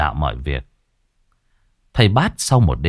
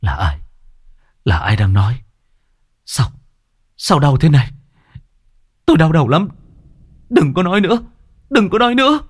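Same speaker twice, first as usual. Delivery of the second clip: delirious pain; 7 semitones higher, faster than usual. Acted. Đang delirious with headache begs voices to stop, rising pained cries. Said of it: "gần như mê sảng".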